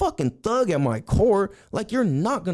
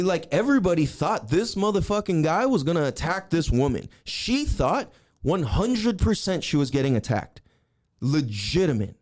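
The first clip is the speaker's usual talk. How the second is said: ordinary delivery, nothing marked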